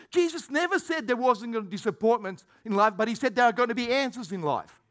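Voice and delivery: ordinary talk, nothing marked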